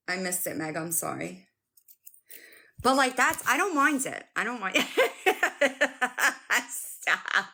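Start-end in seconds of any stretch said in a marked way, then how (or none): 4.8-6.6 high, sustained giggling; real laughter. Real, not acted. Streamer reacting to chat, high giggles. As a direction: crack up mid-sentence.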